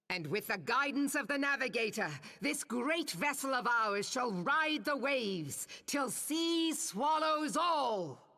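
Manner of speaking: rally the crew, ending in a roar; stirring admiral's rally climbing to a shout; rousing battle cry. Acted.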